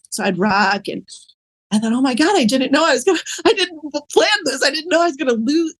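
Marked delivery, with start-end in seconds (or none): 1.9-5.7 high, fast, alarmed rush of words; shocked inner cry. Retold or imitated. Woman recalling her shock at losing herself, high and panicked. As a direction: blurt it out in sudden panic. Retelling sudden shock in rocking chair.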